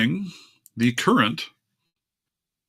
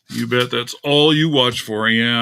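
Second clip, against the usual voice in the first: ordinary talk, nothing marked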